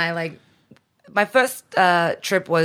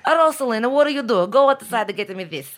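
put-on higher-pitched Italian accent, ordering someone about; Italian-accented father; 3 semitones higher than usual. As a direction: imitate an Italian immigrant dad. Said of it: "My father sounds like that".

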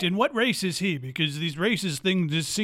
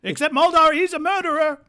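high, loud interjection; loud accusation; 9 semitones higher, 6 dB louder than usual. Acted. Cutting in on Muldar's excuse, loud accusation. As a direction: call it out over the other speaker.

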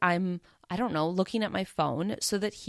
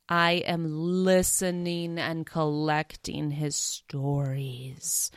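slow, half-whispered savoring of every word; embodied listening; much slower than usual. Shown as a demonstration. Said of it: "I say it with that tone of voice".

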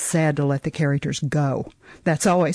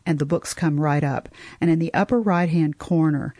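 usual speaking voice

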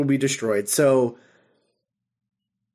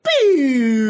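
cliffhanger sting; 10 semitones higher, 5 dB louder than usual. Shown as a demonstration. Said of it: "where you go".

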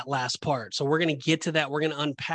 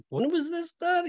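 much higher-pitched mock puzzlement; puzzled reader; 14 semitones higher than usual. Host voices a reader puzzled by Bible dates, high and questioning.

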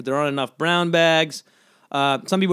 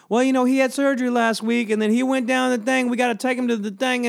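higher, rambling small-town gossip; a customer tells the cashier family news, higher and rambling; chatty storyteller; 7 semitones higher than usual. Retold or imitated. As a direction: tell the news like a neighbor would. Mimicking customers' stories.

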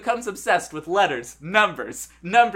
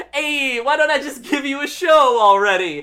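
raised, louder network boss voice; Johnny Alphabets; 6 semitones higher, 5 dB louder than usual. Acted. Network head gives in to creators, exasperated. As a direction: give in loudly to the pests. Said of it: "they get so aggressive that the head of A B C".

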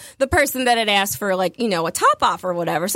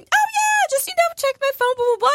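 fake cheery brush-off; faster than usual.

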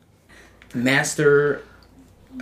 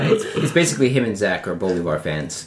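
ordinary delivery

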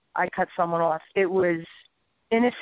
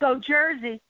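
high-pitched playful hometown cheer; obnoxious cheer; 6 semitones higher, slower than usual. Pretend. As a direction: cheer high like a rowdy local fan. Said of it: "I'm being obnoxious".

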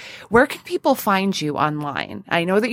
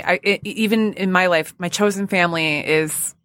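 ordinary talk

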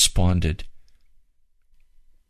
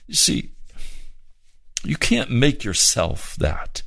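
usual speaking voice

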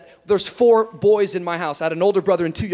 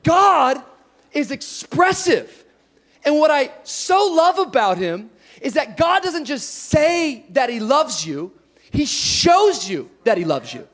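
preaching; 5 semitones higher, slower than usual. Acted. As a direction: preach it out with full force. Slow emphatic preaching.

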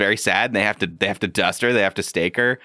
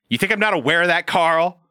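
high, exasperated modern sass; bitchy modern Dracula, laughing at the end; sassy Dracula; 8 semitones higher than usual. Retold or imitated. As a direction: roll your eyes at Carl. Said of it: "Dracula goes".

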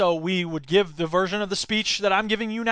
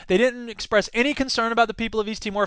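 ordinary delivery

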